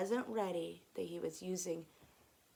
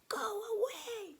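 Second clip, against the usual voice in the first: 意地悪な真似声; 12 semitones higher than usual. Acted. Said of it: "say mean things".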